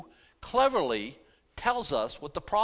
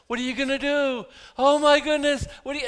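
higher and louder, a panicked outcry; mock alarm; 10 semitones higher, 7 dB louder than usual. Preacher imitating riled-up believers, loud and alarmed. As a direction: play the panic others stir up. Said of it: "they're all trying to get you all riled up".

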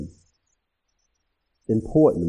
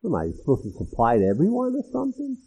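indignant rich guest; 5 semitones higher than usual. Retold or imitated. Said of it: "You can almost hear them making their case".

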